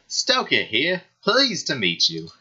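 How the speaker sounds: a hint of an eager, friendly character introducing himself; happy and cheerful. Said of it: "happy and cheerful".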